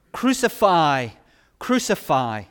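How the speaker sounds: loud cry of the crowd, falling away on the second; crowd shouting. Acted. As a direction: shout it like the angry priests. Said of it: "they shouted".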